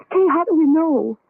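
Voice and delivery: high, crying plea full of fear; tearful plea. Acted. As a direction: beg her with tears in the voice. Frightened woman pleading about evil.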